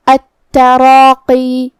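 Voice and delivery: التراقی with heavy, full-mouthed را and long drawn الف, very slow; slow tajweed demo of التراقی with thick را; heavy recitation. Shown as a demonstration. Teacher reciting to students, slow heavy التراقی. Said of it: "ہم یہ دونوں حرف کو بھاری آواز میں پڑھیں گے".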